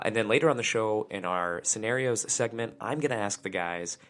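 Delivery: ordinary talk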